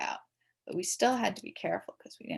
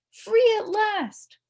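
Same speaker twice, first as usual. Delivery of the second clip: jubilant cry; 14 semitones higher, 8 dB louder, much slower than usual. A narrator's reading. Kids run out after school.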